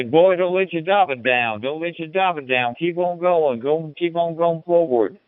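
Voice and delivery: a hint of a hollering coach egging his player on; high school coach urging the boy on, in a light mock Texan coach voice; old Texan coach. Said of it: "he'd say".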